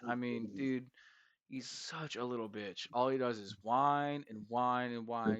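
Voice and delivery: ordinary talk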